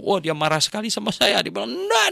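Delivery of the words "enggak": sharp high indignant no; offended man shouting no; angry retort. Retold or imitated. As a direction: snap back in offended refusal.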